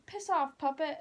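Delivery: put-on high-pitched British accent. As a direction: imitate a friend's British accent. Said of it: "he would always say".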